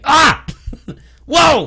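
high laughing yell. Shown as a demonstration. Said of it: "like, yell, like".

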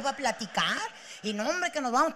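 ordinary delivery

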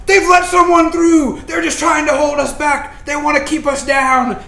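shouted protest of an angry crowd; rioting crowd. Acted. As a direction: rouse the mob with indignant cries. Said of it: "they say".

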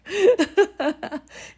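high, loud burst of laughter; hearty high-pitched laughing fit; real laughter. Real, not acted. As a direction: laugh out loud at your own line. Laughs at own retort.

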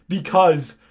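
loud, high, halting proclamation; dramatic. Acted. Hagrid pauses mid-air before explaining, loud dramatic delivery. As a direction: boom it twice with a pause. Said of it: "he paused in the air dramatically".

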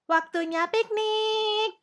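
very high, drawn-out squeal of words; excited child's shout. A narrator's reading. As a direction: call it out slowly like a thrilled little princess. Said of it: "teriak Putri Kecil".

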